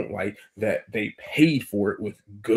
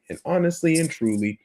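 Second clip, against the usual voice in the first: normal talking voice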